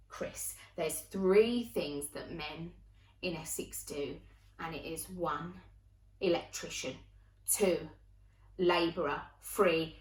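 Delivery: low, slow list with pauses; pondering inner thought. Retold or imitated. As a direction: count off options to yourself, deliberating. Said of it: "I thought in my head".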